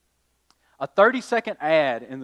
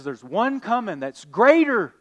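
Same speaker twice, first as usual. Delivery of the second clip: higher, louder prophetic call; a proclaiming Moses, raised voice; 7 semitones higher, 6 dB louder than usual. Retold or imitated. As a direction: announce it like a prophet. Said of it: "Moses says".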